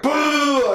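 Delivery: high, loud playful boo; mock boo. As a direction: jeer like a cartoon army.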